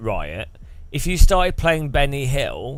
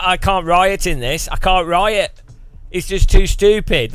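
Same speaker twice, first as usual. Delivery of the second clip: a mock-frustrated protester, a bit higher and louder, growing; lightly voiced rioter put off by silly music; exasperated rioter; 6 semitones higher, 5 dB louder than usual. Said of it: "They'd be like".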